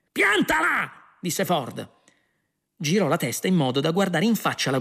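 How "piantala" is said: high-pitched exasperated snap; irritated order. A narrator's reading. Prisoner telling the guard to quit, exasperated snap. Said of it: "E sta zitto anche tu".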